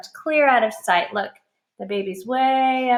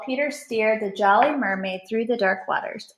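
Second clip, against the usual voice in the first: plain reading voice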